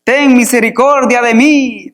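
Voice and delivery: high, drawn-out acted call; crying out. Preacher reading blind Bartimeo's plea to Jesus, crying out. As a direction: call out as if over a crowd. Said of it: "comenzó a dar voces".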